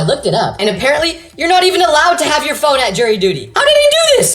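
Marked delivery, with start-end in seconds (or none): 3.5-4.3 very high, loud squawk; incredulous outburst. Acted. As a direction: shriek it in comic disbelief. Baffled outburst about friend.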